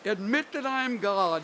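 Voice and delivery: loud high command with stress on that; loud proclamation. Retold or imitated. Preacher reading Moffat's version aloud, loud commanding voice. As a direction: proclaim it as God speaking. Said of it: "he cries".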